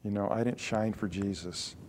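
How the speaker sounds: a believer quietly regretting with a sigh; regretful. Retold or imitated. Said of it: "go home and weep".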